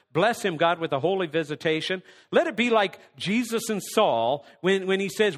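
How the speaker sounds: ordinary delivery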